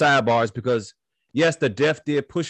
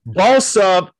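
loud, high hype call; hype shout; 7 semitones higher, 9 dB louder than usual. Real, not acted. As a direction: pump up the listeners. Shout-out to listeners.

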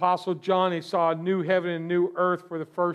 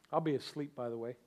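quiet deadpan aside; 6 semitones lower, 11 dB quieter, faster than usual. Real, not acted. Deadpan aside after cheer.

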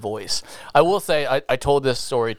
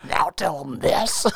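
Vince McMahon voice; 2 semitones higher, slower than usual. Retold or imitated. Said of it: "talking like Vince McMahon".

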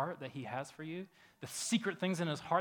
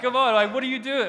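louder and much higher, incredulous; exasperated disbelief; 10 semitones higher, 14 dB louder than usual. Retold or imitated. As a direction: throw up your hands in disbelief. Mock exasperation at Jesus.

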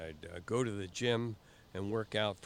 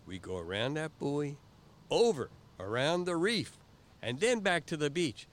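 higher, louder insistence on the course; raised, confident retelling; firm correction; 5 semitones higher, 4 dB louder than usual. Retold or imitated. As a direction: lay out the plan like you're in charge. He counters with own course.

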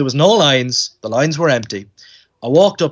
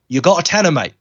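put-on British accent; 4 semitones higher than usual. British actor repeats his demand to a child fan, British accent. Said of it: "in a real British accent".

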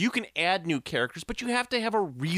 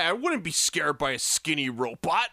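normal talking voice

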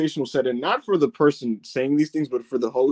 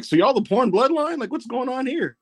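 high disbelieving question; pitched-up amazed questioning; incredulous; 10 semitones higher than usual. Retold or imitated. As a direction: ask it as if you can't believe it. Incredulous question in retold story.

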